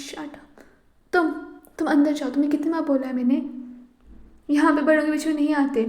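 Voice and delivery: put-on loud, fast scolding of a child; furious mother telling her son off; angry scolding. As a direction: send the kid inside, sharp and loud.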